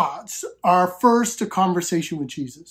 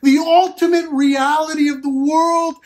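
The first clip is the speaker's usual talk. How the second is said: fervent preaching; 4 semitones higher than usual. Acted. Preacher urging the congregation about God as Father, fervent and raised. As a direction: preach it out, building conviction.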